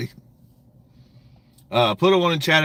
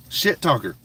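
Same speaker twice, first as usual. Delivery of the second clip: ordinary talk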